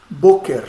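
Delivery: "boker" with the stress on "bo"; stress on first syllable. Shown as a demonstration. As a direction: lean on "bo" in "boker", then release "ker". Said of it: "olha a tonicidade aonde está".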